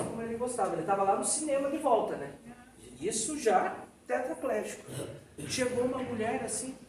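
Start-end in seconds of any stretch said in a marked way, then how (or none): none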